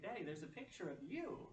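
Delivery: retold child's emphatic repeat; insistent little son. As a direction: insist like a small child, lifting "you". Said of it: "he's emphatically pointing".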